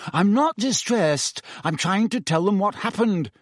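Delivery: high-pitched, exasperated anger; furious. A narrator's reading. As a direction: protest with contained fury. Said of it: "Harry said furiously".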